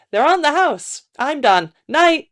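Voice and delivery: high, casual trader's voice; another trader. A narrator's reading. Trader giving bones free and leaving, high voice.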